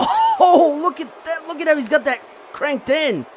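high, excited hold call; wrestling commentator. Real, not acted. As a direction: marvel at the hold, voice way up. Submission hold gets locked in.

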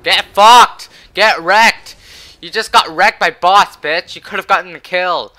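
loud, high taunting yell; triumphant trash-talk shout; gloating shout. Real, not acted. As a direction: rub it in their face, loud. Taunting enemy after a kill.

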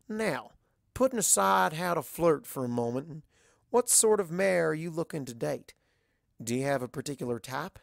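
lightly voiced Southern farm mare, frank and friendly; level with her like a blunt country friend; a hint of a drawling Southern mare, teasing; Applejack voice.

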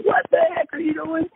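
screechy retold scream at held-back volume; imitated screaming. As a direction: act out the man yelling in a high, frantic pitch. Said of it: "running and screaming and yelling".

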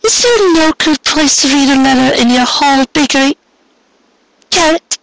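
very high, hammed-up theatrical sighing; high melodramatic dearie me; mock disappointment. A narrator's reading. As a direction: put on grand fake disappointment, way up high. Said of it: "affecting the airs of one wrapped in deep disappointment".

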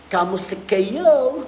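slow Filipino-accented greeting; lightly Filipino-accented, slow hello. Shown as a demonstration. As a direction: stretch Kamusta kayo like a friendly Filipino. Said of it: "Filipino accent".

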